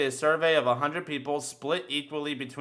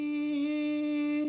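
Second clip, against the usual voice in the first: imitated squeal; 12 semitones higher, 6 dB quieter than usual. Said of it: "old guy comes in going".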